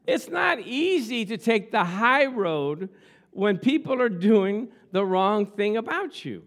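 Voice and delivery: rising, slow, emphatic preaching; booming motivational speech. Acted. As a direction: preach it, drawing out each phrase. Preaching at full force.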